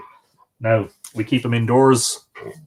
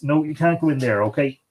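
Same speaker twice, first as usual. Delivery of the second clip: usual speaking voice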